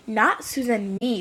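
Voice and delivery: ordinary talk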